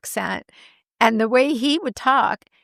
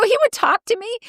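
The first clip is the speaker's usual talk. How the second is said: very high, laughing through it; laughing disbelief; 13 semitones higher than usual. Retold or imitated. Retelling her reply to her mom, laughing high. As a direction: squeal it while laughing. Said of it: "I go".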